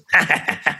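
put-on a short eerie giggle from the possessed girl; creepy spirit laugh. Spirit mocking threat of exorcism, eerie high laugh. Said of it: "very creepy version".

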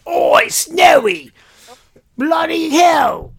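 high, loud, slow mock English accent; bad English accent. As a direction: shout it in a hammy English accent. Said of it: "talked in bad English accents".

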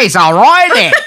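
high, loud, laughing fake Australian; laughing, overblown stab at an Australian accent; bad Australian accent. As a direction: ham up a botched Aussie accent through a laugh. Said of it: "I don't think that's Australian".

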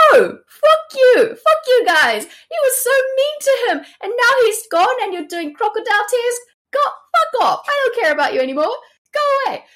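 very high-pitched, hammed-up shouting fit; Henry's outburst. Grieving Henry sends the false mourners away, hammed-up fury. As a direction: explode like a wailing, furious child. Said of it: "And Henry's like".

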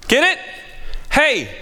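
usual speaking voice

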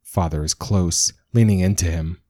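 plain reading voice

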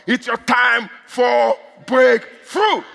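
slow, word-by-word preacher's shout; drawn-out proclamation. Real, not acted. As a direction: stretch each word and punch "breakthrough". Proclaims it to congregation.

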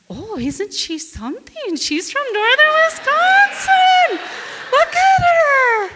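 high, loud, slow mock cheer; drunk guys cheering. Drunk men on the boat cheering her, hammed-up loud cheer. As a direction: ham up the drunk admirers.

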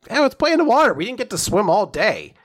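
mock cheerful plea. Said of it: "she's just in a really good mood".

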